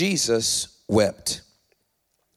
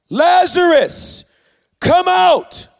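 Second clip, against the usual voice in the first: high, full-voiced, drawn-out shout; commanding shout; 11 dB louder, much slower than usual. A narrator's reading. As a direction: call the dead out with a slow, booming command. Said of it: "Then Jesus shouted".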